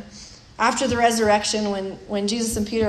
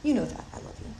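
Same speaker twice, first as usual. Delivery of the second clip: lip service; 8 dB quieter, faster than usual. Retold or imitated. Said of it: "just giving lip service".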